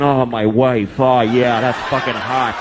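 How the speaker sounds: mock aroused husband. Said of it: "their husbands are going".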